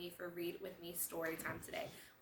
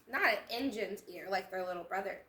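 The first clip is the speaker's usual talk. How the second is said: usual speaking voice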